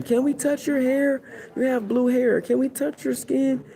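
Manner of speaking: high, eager fan voices; pestering admirers. Acted. Admirers asking to touch his blue hair, high and giddy. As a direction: beg to touch him like giddy fans.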